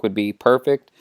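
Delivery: plain reading voice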